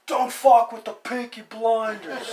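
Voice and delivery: high, very loud, slow Peaky Blinders yell; hammed-up shout, drawn out like a bad TV impersonation. Host attempts a Peaky Blinders impersonation, slow high-pitched shout. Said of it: "um, impersonations".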